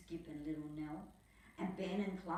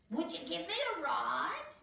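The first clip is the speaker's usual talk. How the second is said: high, louder put-on toad voice, asking; cane toad voice; 10 semitones higher, 5 dB louder than usual. Cane toad asking the travellers for a ride, high put-on voice. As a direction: squeak it out like an eager toad. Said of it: "he said".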